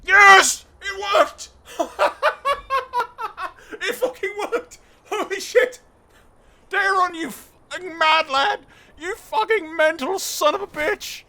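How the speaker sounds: high, very loud shouts broken by laughter; ecstatic, full-voiced screaming at a win; real triumphant screaming. Real, not acted. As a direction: yell in disbelieving, laughing celebration. Celebrates an unlikely game success.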